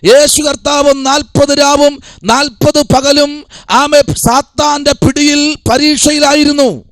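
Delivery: high, driving sermon delivery; impassioned preaching. Real, not acted. Preacher tells congregation of Jesus' forty-day trial, impassioned preaching. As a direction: preach it high and sustained, phrase after phrase.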